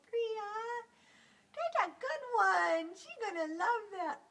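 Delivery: high, slow praise for the kitty; pet talk. Real, not acted. Sitter praising the cat in high baby talk. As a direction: coo approval up high.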